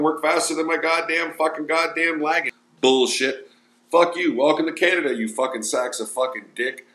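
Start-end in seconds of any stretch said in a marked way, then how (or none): none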